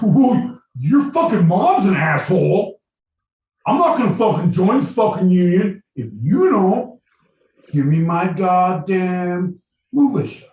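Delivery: high, loud hammed-up outrage; shouted, over-the-top quarrel; mock angry rant. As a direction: yell the insult and ultimatum in comic fury. Acted quarrel, Mason insulting Madison.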